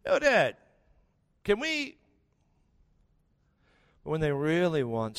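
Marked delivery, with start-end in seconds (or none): 0.1-2.0 put-on casual kid. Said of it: "they probably will now".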